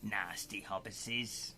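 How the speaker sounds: slow, quiet Gollum impression. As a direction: creep it out like a sneaky creature. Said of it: "we have to all be Gollum".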